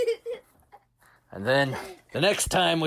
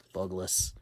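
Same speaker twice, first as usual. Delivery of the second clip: smitten murmur; 6 semitones lower, 8 dB quieter than usual. Acted. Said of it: "Bugless stopped and he said".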